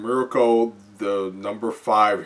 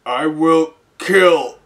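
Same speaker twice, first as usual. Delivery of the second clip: slow, louder robotic monster; 6 semitones higher, 7 dB louder, much slower than usual. Acted. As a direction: drag it out like a robot. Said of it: "says the robotic Haya Nomu".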